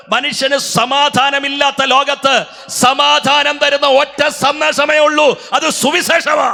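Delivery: high, loud, slow preacher's cry building to a shout; preaching at full force. Acted. Pastor stirring the convention crowd about peace, preaching at full force. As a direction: lift the crowd, ringing out each phrase.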